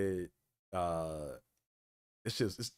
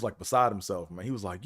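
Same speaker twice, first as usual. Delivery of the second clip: usual speaking voice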